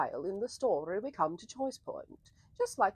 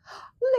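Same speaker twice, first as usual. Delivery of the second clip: delighted gasp; 6 dB louder than usual. Real, not acted. Delighted greeting of arriving viewer.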